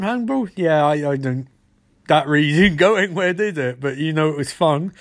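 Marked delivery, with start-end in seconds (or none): none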